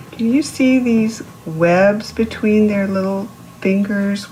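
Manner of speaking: usual speaking voice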